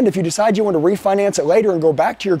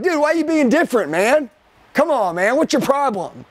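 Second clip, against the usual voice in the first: higher-pitched imitation of a complaining buddy; mock protest; 6 semitones higher than usual. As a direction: act out a friend challenging the change. Friend objecting to the change, acted.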